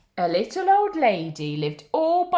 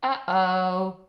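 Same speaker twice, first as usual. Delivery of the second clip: plain reading voice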